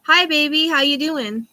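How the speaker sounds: mimicking her bird saying hello; parrot imitation. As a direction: chirp it like the parrot does. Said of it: "She says".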